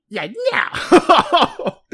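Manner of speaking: high, loud imitation of the refusal; mock shout. Host copying co-host's Whitebeard voice, in a loud high mock shout. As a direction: echo the big "no" back, hammed up. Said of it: "in that voice, too".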